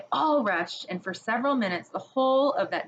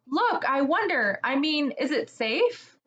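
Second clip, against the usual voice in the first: worried; 6 semitones higher than usual. Acted. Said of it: "said Susan in a different tone".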